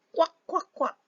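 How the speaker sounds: high quacking ducklings; duckling quacks. Acted. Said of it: "their wings and said".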